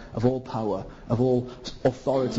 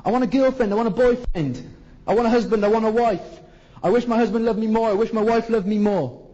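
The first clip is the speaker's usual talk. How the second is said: higher, louder whiny wish list; mock needy wanting; 6 semitones higher, 3 dB louder than usual. Preacher mimicking people craving partners, whiny mock. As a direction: parody the self-centred wanter. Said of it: "You're bothered about relationships".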